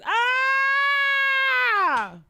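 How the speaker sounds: high, loud shriek; frustrated scream. Retold or imitated. Vlogger voicing Brandy's frustration, one loud scream. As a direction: let out a shrill mock scream. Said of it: "that's how she feeling".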